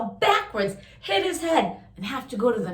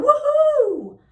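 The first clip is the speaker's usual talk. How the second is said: much higher, loud woohoo; cheering; 6 dB louder than usual. Acted. Reader cheers Molly's touchdown, loud high whoop. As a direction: cheer like the crowd just saw a touchdown.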